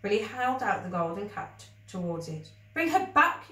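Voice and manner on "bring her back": high, loud pleading shout; a frantic shout at the creature; cried. Acted. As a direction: demand it, desperate. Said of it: "he cried".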